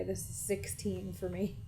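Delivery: normal talking voice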